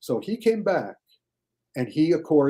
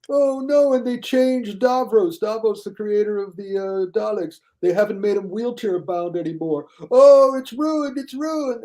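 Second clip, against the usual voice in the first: high, mocking outraged whine; whiny critic; 7 semitones higher than usual. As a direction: play a pouting fan mourning Davros, pitched high. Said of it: "And it's like".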